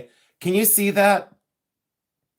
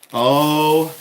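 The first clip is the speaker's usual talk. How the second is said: a loud startled "Oh"; sudden yell; 7 dB louder than usual. Real, not acted. Searching for her loupe, yells out loud. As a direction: blurt it out loud. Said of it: "why am I yelling?".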